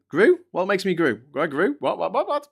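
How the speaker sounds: high puzzled questioning; baffled, rising each time; confused. Real, not acted. Streamer puzzled by a garbled chat message, high questions.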